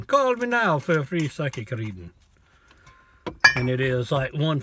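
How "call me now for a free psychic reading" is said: a quick mock Jamaican psychic-hotline ad; hammed-up Jamaican accent like a late-night TV ad; put-on Jamaican accent, ad pitch. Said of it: "but I like doing the accent".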